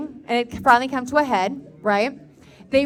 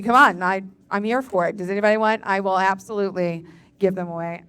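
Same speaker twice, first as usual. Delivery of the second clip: normal talking voice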